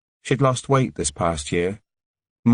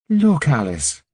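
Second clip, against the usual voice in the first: bright, merry Irish lilt, pitched higher; merry Irish accent; 7 semitones higher, 3 dB louder, slower than usual. A narrator's reading. A nurse brings Alice roses, in a merry Irish accent. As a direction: greet her like a cheerful Irish carer. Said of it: "in her merry Irish accent".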